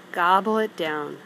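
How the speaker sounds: usual speaking voice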